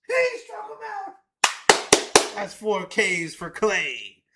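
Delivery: high, loud, slow celebration; real victory yell; triumphant shout. Real, not acted. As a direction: shout out the strikeout. Strikeout celebration.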